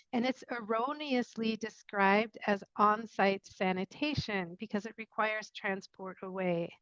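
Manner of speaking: normal talking voice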